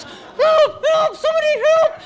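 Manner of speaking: very high, loud, fast squeal; mock-panicked high shrieks; hammed-up cries for help. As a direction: play a captured child calling for rescue. Captive child calling for help.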